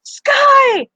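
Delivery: high, loud joyful scream of a name; excited shout of reunion; scream at the top of her lungs. Acted. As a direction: shriek the name across the path. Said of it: "screams at the top of her lungs".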